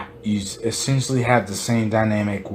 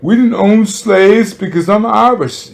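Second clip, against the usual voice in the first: high, loud mocking voice of an Irish American, much higher and louder; mock an Irish American's excuse, pitched up high and loud; mocking Irish person; 10 semitones higher, 9 dB louder than usual. Speaker mocking Irish Americans who deny slavery, high and loud. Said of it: "A lot of Irish people like to be like".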